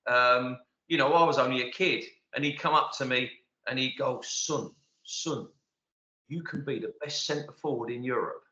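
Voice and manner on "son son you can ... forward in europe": put-on a lightly done, soft Scottish accent, not very convincing; half-hearted Scottish manager's voice, quietly encouraging a kid; weak Scottish accent. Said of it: "that weren't a very good Scottish accent".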